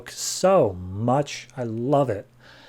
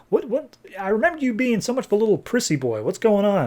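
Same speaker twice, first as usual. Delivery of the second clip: a lightly put-on higher, quick teasing voice; mild mock taunt, faster and higher; 8 semitones higher than usual. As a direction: tease him, a hint of mockery in a raised voice. [name] mocking Andrew.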